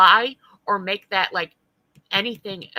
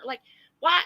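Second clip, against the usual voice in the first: ordinary delivery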